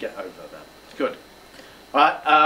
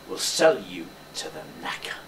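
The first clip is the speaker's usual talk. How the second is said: low, quiet menace; frightening threat; 7 semitones lower, 6 dB quieter than usual. Shown as a demonstration. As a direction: drop pitch and volume to threaten. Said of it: "make it sound like that".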